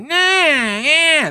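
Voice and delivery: high, far-off imitation of a Southern teacher; Mister Peacock impression. Student mimics his old teacher's strange voice, pitched high. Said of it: "That's how he sounded".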